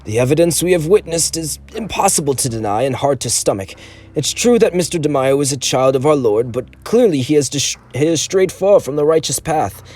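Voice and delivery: put-on mild old angel of mercy; elderly defender. A narrator's reading.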